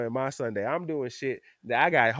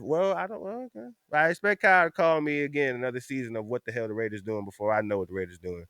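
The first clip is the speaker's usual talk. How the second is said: normal talking voice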